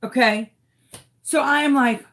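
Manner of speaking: usual speaking voice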